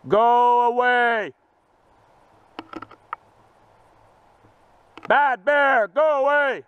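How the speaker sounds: long, loud, drawn-out yell; scaring off a bear. Real, not acted. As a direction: shout slowly to drive it off. Yelling at bear.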